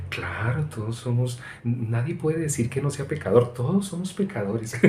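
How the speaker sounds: hushed, put-on sweet piety; mock a meek little puppet, softly; little cartoon-doll voice. Preacher imitating religious people's special talk, soft doll voice. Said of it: "como un muñequito".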